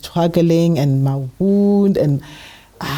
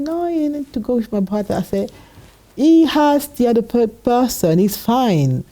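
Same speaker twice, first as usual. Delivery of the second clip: higher mock plea to stay; high pleading; 7 semitones higher than usual. Wife begging husband to stay home: high pleading.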